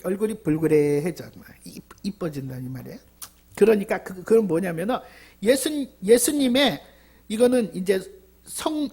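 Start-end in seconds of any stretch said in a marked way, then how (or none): none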